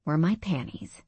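ordinary delivery, nothing marked

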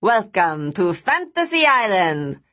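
put-on deadpan Latin accent, slow and grand; slow deadpan Latin American host. A narrator's reading. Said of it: "deadpanned in a Latin accent".

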